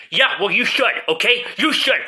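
loud, high indignant retort; arguing with myself. Acted. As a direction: snap back, offended and loud. Quarrel with stoned self.

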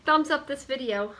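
high, loud greeting to viewers; bubbly video greeting. Pretend. Thanking viewers for clicking, in a high, bubbly voice. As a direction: pitch it way up to welcome everyone.

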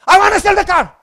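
high, loud, stubborn insistence; frustrated outburst. Retold or imitated. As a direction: blurt it like a frustrated husband. Angry reply to his wife.

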